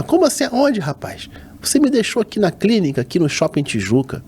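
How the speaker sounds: mother's voice. Retold or imitated. Mother scolding on the phone.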